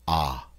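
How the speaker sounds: "Ah" low and soft, like the A in father; ah sound. Shown as a demonstration. Teacher repeats the vowel for learners, soft open ah sound. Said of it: "that's like the A in father".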